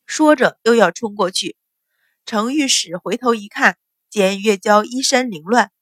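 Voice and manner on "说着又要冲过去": ordinary delivery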